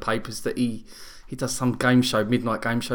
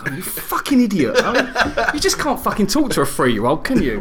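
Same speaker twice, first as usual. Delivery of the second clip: exasperated laugh; 7 semitones higher than usual. Retold or imitated. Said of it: "exasperated aside".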